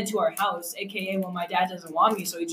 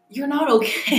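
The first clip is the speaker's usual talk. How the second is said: melodramatic confession; 5 semitones higher than usual. Pretend. Dramatic reveal of inner sadness.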